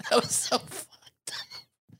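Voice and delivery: breathless and laughing, slow; a friend reacts to the story, laughing helplessly. Real, not acted. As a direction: wheeze it through laughter.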